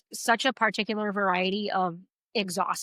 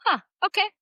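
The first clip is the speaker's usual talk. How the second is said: baffled; 11 semitones higher, 3 dB louder, faster than usual. Retold or imitated. Reacting to neighbor's odd saying.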